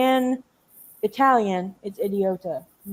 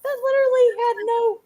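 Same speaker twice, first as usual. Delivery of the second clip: stunned disbelief; 13 semitones higher than usual. Real, not acted. Continued disbelief.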